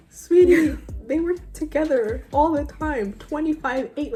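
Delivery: high, slow, mock-patient lecture; condescending correction. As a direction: spell it out as if to a clueless fan. Correcting his Twilight claim.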